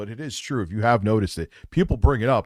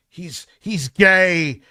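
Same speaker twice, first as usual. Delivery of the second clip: higher, louder, hammed-up caricature; mocking caricature voice; 4 semitones higher, 3 dB louder than usual. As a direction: lampoon him in a put-on voice. Mocking impression of rival.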